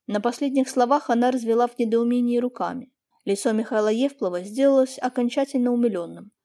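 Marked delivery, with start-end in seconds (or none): none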